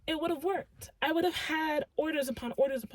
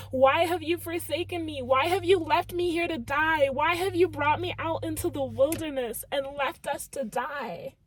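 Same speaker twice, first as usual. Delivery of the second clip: loud, raised cry of reproach; anguished channeled plea; 3 semitones higher, 6 dB louder than usual. Acted. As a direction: cry out to God as if abandoned. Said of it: "I can literally hear someone saying".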